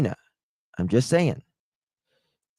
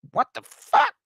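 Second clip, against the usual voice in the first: much higher, startled yelp; shocked squeal. Real, not acted. Streamer startled by a chat message, shocked squeal. Sudden reaction.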